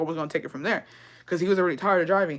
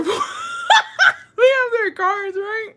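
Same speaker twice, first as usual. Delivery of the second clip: high, laughing as she speaks; can't hold the laugh in; laughing through words; much slower than usual. Real, not acted. Telling friends the border story, laughing through it.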